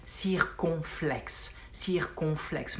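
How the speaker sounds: "circonflexe" drawn out syllable by syllable; slow demonstration. Shown as a demonstration. Teacher naming the accent for learners, slow and measured. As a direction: say "circonflexe" slowly, each syllable apart. Teacher repeats new word slowly.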